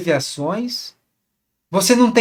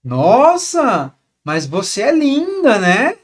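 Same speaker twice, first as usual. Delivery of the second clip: drawn-out, rising mock admiration; sarcastic tone; 4 semitones higher, 5 dB louder, much slower than usual. Shown as a demonstration. Coach demonstrates how a compliment can mean the opposite, sarcastic. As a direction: stretch NOSSA and LINDA with exaggerated, insincere swoops. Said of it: "com vários tons diferentes".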